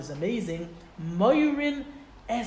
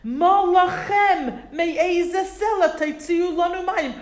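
higher, louder and slow, with a laugh; mock screaming crowd; 9 semitones higher, 5 dB louder, slower than usual. Thirsty people screaming at Moshe and Aaron, mock angry. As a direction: play the thirsty mob yelling at their leaders. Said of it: "they start to scream at Moshe".

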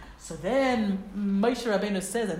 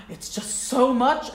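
slow, louder, pleading and overwhelmed; overwhelmed sob line; 4 semitones higher, 7 dB louder, slower than usual. Shown as a demonstration. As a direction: let it spill out like giving up. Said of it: "you're like".

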